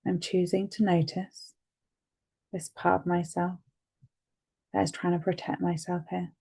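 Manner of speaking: steady, soothing guide through each tapping point; guided tapping session. Acted. Therapist guiding a listener through tapping, calmly.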